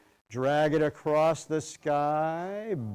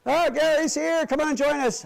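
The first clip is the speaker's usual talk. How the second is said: high, bright welcome; excited greeting; 11 semitones higher, faster than usual. Real, not acted. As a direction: happily call a latecomer in. Greeting latecomer mid-class.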